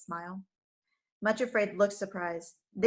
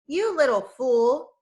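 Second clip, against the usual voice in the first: sneering villain; 3 semitones higher, 8 dB louder, slower than usual. Acted. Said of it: "he continued".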